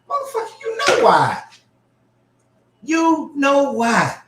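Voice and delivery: high, loud, drawn-out you know why; exasperated retort. Acted. Answering the angel for Balaam, high and exasperated. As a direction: fire it back in disbelief.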